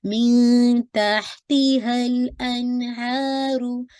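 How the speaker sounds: slow chanted मिन तहतिहल अनहारू; tajweed recital; Quran recitation. Shown as a demonstration. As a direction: recite melodically with clear breath on ha. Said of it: "तहा पे आपका hums वाज़े हो".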